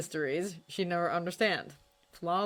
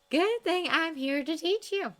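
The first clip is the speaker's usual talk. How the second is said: tender teasing; 12 semitones higher, 4 dB louder than usual. A narrator's reading. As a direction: tease him gently.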